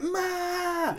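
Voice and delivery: high cry of the name Ma; mock shout. Host calling out to the film's Ma, mock shout. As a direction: call out to her across the room.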